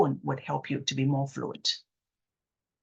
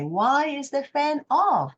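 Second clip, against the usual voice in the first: "Why is the fan off?" drawn out slowly and higher; slow, one breath; 9 semitones higher, slower than usual. Shown as a demonstration. Coach modelling a slow one-breath question for stutterers. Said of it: "instead of, you know, who, you change a why".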